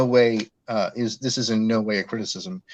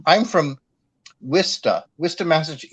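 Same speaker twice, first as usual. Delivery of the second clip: ordinary talk, nothing marked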